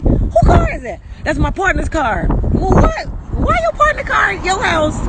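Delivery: acted-out disbelief, pitch jumping high; incredulous questioning. Woman re-enacting quarrel over partner's car, high incredulous questioning.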